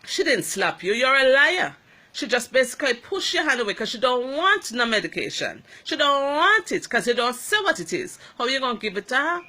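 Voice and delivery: mother snapping back in defence; a loud rebuke cut with laughter; mother's angry defence. Retold or imitated. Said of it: "my mother jumped in".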